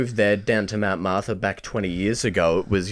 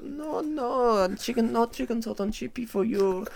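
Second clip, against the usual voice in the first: much higher, softer mock Chinese accent, laughing; 12 semitones higher, 6 dB quieter, slower than usual. Second shop lady saying no, high mock Chinese accent with laughter. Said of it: "but I'll do Chinese".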